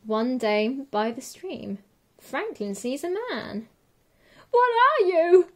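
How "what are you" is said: high, loud scared cry; frightened cry. Acted. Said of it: "cries the man, quaking in his boots".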